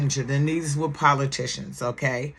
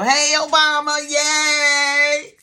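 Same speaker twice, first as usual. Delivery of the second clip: excited cheer; 9 semitones higher, 5 dB louder, slower than usual. Real, not acted. Cheering for Obama.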